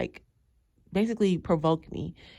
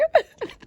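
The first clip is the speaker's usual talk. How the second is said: sudden squealing laugh; real high laugh; high burst of laughter; 5 dB louder than usual. Real, not acted. Laughing at own story.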